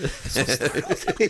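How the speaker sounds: slow, laughing mock fright; cracking up mid-imitation; startled parody. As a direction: repeat it high through laughter. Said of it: "he's just startled".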